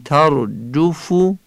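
"ترجفو" with short Arabic u vowels; Arabic accent. Shown as a demonstration. Teacher shows the correct tone, Arabic accent. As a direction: say "ترجفو" in careful Arabic pronunciation. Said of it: "این لحن عربیه".

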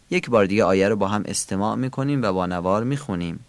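ordinary delivery

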